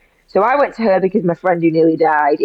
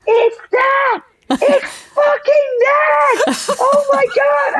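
excited shout; much slower than usual. Retold or imitated. Doctor on the phone to Emma, shouting high and slow. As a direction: yell it like you've finally cracked the case. Doctor finds the cause, calls back.

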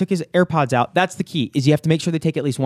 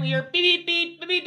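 imitated car horn; 13 semitones higher, 6 dB quieter than usual.

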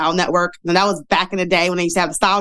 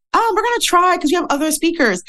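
imitating event girl; 11 semitones higher than usual. Event girl answers her.

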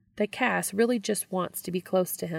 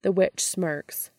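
plain reading voice

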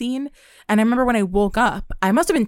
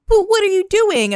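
indignant squeal; 12 semitones higher, 7 dB louder than usual. Retold or imitated. Powerless confronting cheating partner in dream.

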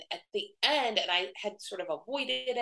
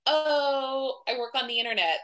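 higher, louder mock evasiveness; retold dodge, pitched up; evasive answer; 7 semitones higher, 6 dB louder than usual. Guest dodging party hosts' question, evasive. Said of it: "a bunch of avoidant answers".

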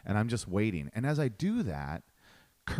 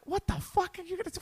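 high, startled panicky exclamation; imitating his own shocked reaction; alarmed outburst; faster than usual. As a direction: caught off guard, voice jumps up. Reacting to an imminent fight.

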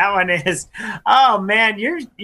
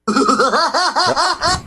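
giggly sinister sing-song; 10 semitones higher than usual. Pretend. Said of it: "I didn't have a killer clown laugh".

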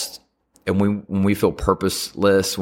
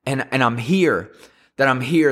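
high, insistent reassurance; God's urgent message, pitched up; mild acted crying out; 6 semitones higher than usual. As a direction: call it out high without shouting. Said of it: "it's God screaming out to us".

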